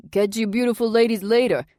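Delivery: loud, high Jersey call-out; strutting Jersey farewell shout. A narrator's reading. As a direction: shout it in a thick Jersey accent. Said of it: "Emilio shouted in his thick Jersey accent".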